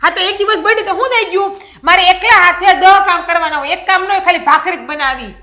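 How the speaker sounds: loud, high indignant yell; wife yelling about her workload, indignant; angry outburst. Acted. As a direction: let the frustration burst out. Wife yells back in quarrel.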